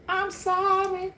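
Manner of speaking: high, slow, whiny mock sorry; mock apology. The ex's friend begging forgiveness, high whiny mock. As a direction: stretch the sorry out high and pitiful. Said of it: "they're gonna come trying to tell you".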